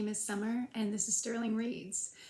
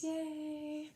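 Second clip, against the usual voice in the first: excited cheer; 8 semitones higher, 5 dB quieter than usual. Real, not acted. Cheering a subscriber milestone.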